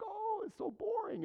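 high, whiny mock complaint; mock whine. Preacher mocking bored listeners' whine. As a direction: mimic bored churchgoers whining. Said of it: "They're like".

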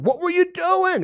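high, sharp accusing question; a hammed-up scolding of the woman; mock accusation. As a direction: jump up high and accuse her.

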